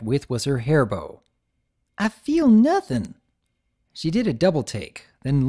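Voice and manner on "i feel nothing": puzzled. Acted. Filly touching her mane.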